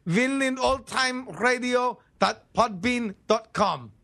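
web address in a put-on Russian accent; stilted Russian-accented announcer. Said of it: "listen to this interview in a Russian accent".

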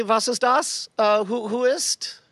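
light mock German accent. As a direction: ask in a hammy German accent. Plays Himmler in German.

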